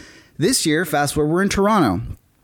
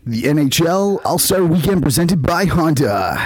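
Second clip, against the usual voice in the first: announcer voice; 3 dB louder than usual. Shown as a demonstration. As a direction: show off your best announcer read. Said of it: "I have skills that you don't know".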